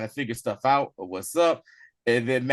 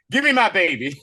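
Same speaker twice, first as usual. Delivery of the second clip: louder, higher acted-out demand; mock demand; 4 semitones higher, 5 dB louder than usual. Jean snatches the baby back, mock demanding. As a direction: play an indignant mother, half in jest.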